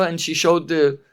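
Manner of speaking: usual speaking voice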